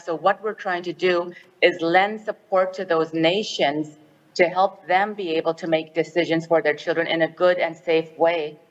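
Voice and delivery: normal talking voice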